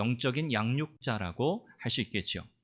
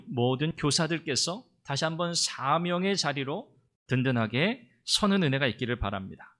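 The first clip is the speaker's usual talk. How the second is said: ordinary talk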